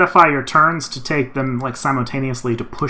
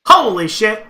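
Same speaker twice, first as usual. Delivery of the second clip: excited; 6 semitones higher, 5 dB louder, slower than usual. Real, not acted. Reacting to the nat twenty.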